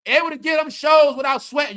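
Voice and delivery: a loud mocking laugh on the words; laughing shout. Real, not acted. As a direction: crack up while you call it out.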